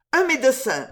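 high, urgent exclamation; urgent call. A narrator's reading. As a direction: call out for help. Urgent call during acted accident. (appel pressant, voix haute)

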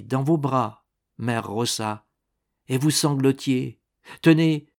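plain reading voice

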